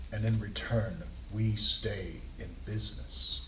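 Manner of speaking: gruff, grizzled and very calm, slow; Mister Witch. Acted. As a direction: speak slowly and softly as a calm, gruff old hand. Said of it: "the gruff, grizzled voice, and very calm".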